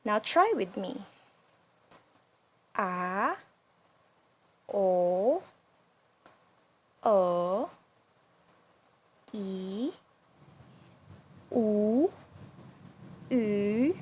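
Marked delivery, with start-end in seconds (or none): 2.8-14.0 long dipping vowels A, o, o, e, u, u; falling-rising third tone. Shown as a demonstration. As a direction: draw out A, o, o, e, u, u with a scoop down and up. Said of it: "goes out first and then goes up".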